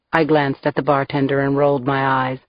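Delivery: ordinary delivery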